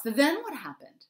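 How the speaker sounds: high, loud, thrilled question; eager Mouse. A narrator's reading. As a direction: press eagerly for what comes next. Mouse, eager listener in story.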